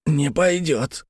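hint at a stranger's husky whisper; hoarse whisper. A narrator's reading. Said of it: "хрипло шепнул незнакомец".

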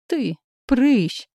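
1 mild roar. A narrator's reading. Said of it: "взревел Исаак".

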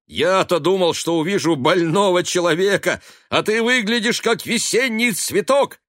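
high, loud, hearty teasing; exuberant shouting. A narrator's reading. Son hugging his sick father, shouting happily. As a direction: cry it out to cheer him up. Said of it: "кричал он".

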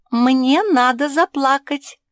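high, loud, drawn-out cry; exclamation. A narrator's reading. Pug telling the crow her rescue plan, exclaiming. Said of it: "воскликнула Жози".

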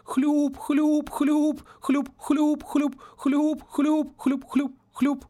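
high, rapid squelch sounds; hurried squelching. A narrator's reading. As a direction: tap out quick high squelches. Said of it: "торопливо застучал по воде".

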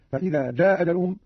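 "جاء أجلهم" run together as jaa-ajaluhum, short vowel; dropped hamza, short. Shown as a demonstration. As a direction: keep "جاء" short and drop its final hamza. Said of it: "بإسقاط الهمزة الأولى مع القصر والمد".

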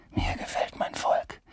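whispered tender confession. Acted. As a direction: breathe it like a secret. Tender confession about his people.

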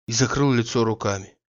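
ordinary delivery

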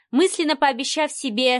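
ordinary delivery, nothing marked

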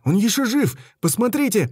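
urgent plea. A narrator's reading. Bart pleading loudly.

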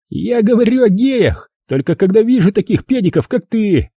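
high contemptuous jab; sneering bully Rob. A narrator's reading. Rob insulting John, high and contemptuous. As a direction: spit the insult back.

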